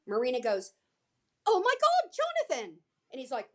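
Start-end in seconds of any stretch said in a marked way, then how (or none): 1.5-2.8 high, louder acted surprise; excited exclamation. Panel host calls to her co-star, acted excitement. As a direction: exclaim it as if spotting a celebrity.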